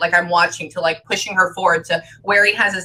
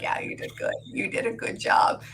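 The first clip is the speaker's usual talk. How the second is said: soft unvoiced whisper; hushed, tender whisper to a spirit; whispered reassurance; 9 dB quieter than usual. Real, not acted. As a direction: whisper it gently, like reassuring him. Spirit reassured during reading.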